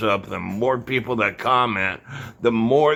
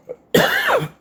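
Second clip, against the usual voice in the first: sharp hacking cough; chesty cough; 5 dB louder than usual. Real, not acted. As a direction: cough it out hard. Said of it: "I'm coughing because".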